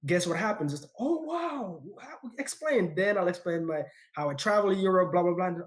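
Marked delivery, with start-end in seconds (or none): 1.0-2.9 a mock seller's "oh wow", much higher; impressed surprise. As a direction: play the wowed seller, pitch way up. Seller reacts to his accent.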